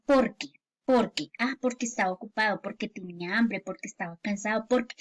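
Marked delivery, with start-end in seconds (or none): 0.0-1.3 PORQUE as one unbroken word; "porque" in one quick piece; joined word. Shown as a demonstration. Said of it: "se pega para que suene". 1.6-4.7 "porque" run quickly into each reason; quick, softening list with PORQUE unbroken; joined word. Shown as a demonstration. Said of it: "si ven que suena junto".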